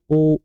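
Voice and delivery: ओ held long and loud as a pluta vowel; pluta vowel demo. Shown as a demonstration. Teacher demonstrates the long ओ to students, drawn out. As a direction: stretch the ओ out long, like chanting ओम. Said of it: "ओम करके बोला जाता है".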